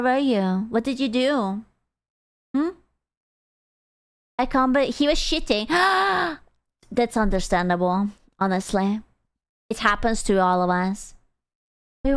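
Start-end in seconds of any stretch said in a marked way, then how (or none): 5.7-6.3 high, audible gasp; mock shocked gasp. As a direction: suck in air as if scandalised. Feigned shock at chat.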